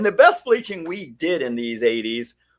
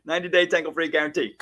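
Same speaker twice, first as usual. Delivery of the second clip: fast demo; 4 semitones higher, much faster than usual. Shown as a demonstration. Rattling it off quickly for Eddie to copy. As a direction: rattle off Ninety-day tangle-free guarantee quickly with a laugh. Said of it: "Say it one more time".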